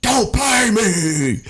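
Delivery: drunk mock yell. Drunk friend yelling at party.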